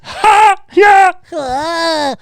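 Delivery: very high, loud mock cackle; hammed-up squeaky laugh; Pee-wee laugh. Streamer mimics Pee-wee's laugh, very high and loud. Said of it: "the whole".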